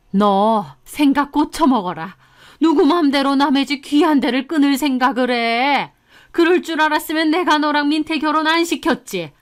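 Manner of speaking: cross, reproachful mother-in-law voice; mother-in-law's angry outburst. A narrator's reading. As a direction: lay down the law to your daughter-in-law. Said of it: "그러면서 역정을 내시는 겁니다".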